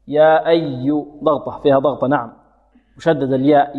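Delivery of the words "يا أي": slow "يا أي" with pressure on the shadda; pressed doubled ya. Shown as a demonstration. Teacher showing students the stressed doubled letter. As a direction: lean on the doubled y in "أي". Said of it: "وأي هنا فيها ضغطة مشددة".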